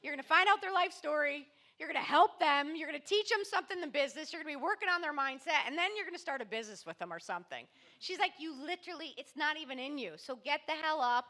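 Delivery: high, loud, fast teasing rant; sister ranting. Retold or imitated. Sister listing what he'd do, high excited rant. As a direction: rattle it off like a sister who knows him. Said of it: "She goes".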